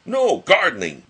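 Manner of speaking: high, loud shouted retort; yelling. A narrator's reading. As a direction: yell it back at Tigger. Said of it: "yelled Rabbit".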